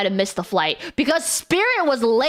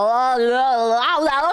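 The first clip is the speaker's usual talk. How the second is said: mocking sing-song. Mocking the heckler.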